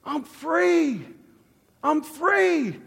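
high, exultant realisation; awed elation. Retold or imitated. Man realising temptation has lost its hold, elated. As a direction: let it rise out in amazed relief.

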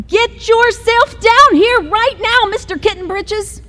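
mother calling her son down, high and commanding; scolding mother. Acted. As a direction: order the kitten downstairs like a fed-up mother. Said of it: "ordered Mama Junebug Jones".

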